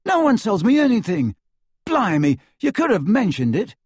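loud, high-pitched complaint; shouting. A narrator's reading. Ron complains to friends about not being told, shouting. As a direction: protest loudly at being left out. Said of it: "shouted Ron".

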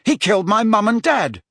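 lightly acted outraged boy; indignant. A narrator's reading. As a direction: insist on it, affronted. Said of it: "said Harry indignantly".

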